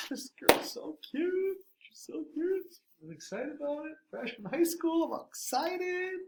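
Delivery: put-on high, slow, swooning teenage gush; giddy crush. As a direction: gush about the cute girl like a smitten freshman. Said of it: "I'm like".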